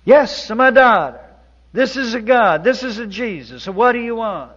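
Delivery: high, loud mock-divine Italian boom; booming voice of God. Painter on scaffold pretends to be Jesus, loud booming voice. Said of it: "Luigi says".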